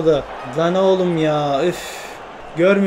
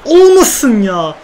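drawn-out plea; 2 semitones higher, 6 dB louder, much slower than usual. Real, not acted. Pleading no offside.

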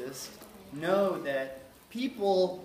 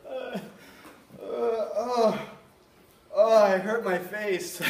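put-on high, loud toddler whine about a hurt face; son's whimper; 7 semitones higher, 9 dB louder, slower than usual. Son complaining to dad he hurt his face, high loud whine. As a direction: play the little boy crying out that he hurt his face. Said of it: "be like".

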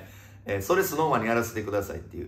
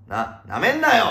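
higher, louder combative jab; mock angry challenge; 8 semitones higher, 7 dB louder than usual. Host warning those who belittle the fight, mock angry. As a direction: throw out a loud tough-guy warning.

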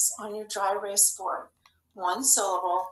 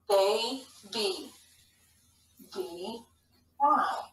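put-on "Baby" in two separate stretched syllables; much slower than usual. Shown as a demonstration. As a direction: sound out "Baby" in two parts. Teacher sounding out syllables to spell.

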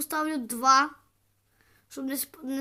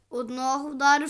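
ordinary talk, nothing marked

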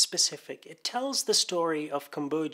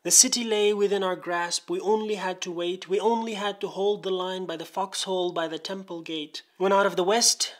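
measured, rhythmic verse reading, gradually building in force; poem recitation; 3 semitones higher, 4 dB louder than usual. Acted. Reader reciting Fenton's war poem to channel listeners, rhythmic poem recitation. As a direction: recite it in rolling cadence, pressing harder as it builds.

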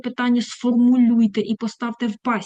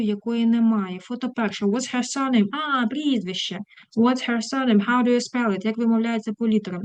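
usual speaking voice

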